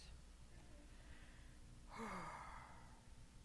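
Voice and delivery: long, soft sigh. Real, not acted. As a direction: let out a long whispery breath. Long audible breath out.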